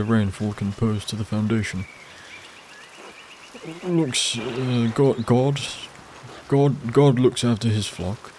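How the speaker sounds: hesitant churchman, halting over his words; pious priest. Acted. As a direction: play a well-meaning, faltering Father. Voices Father Thomas in game.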